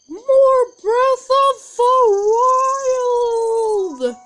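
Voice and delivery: slow, high, loud show opener; excited announcer. Real, not acted. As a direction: belt out the game title like a host. Excited gameplay intro shout.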